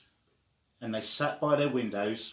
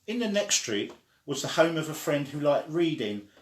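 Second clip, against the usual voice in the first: plain reading voice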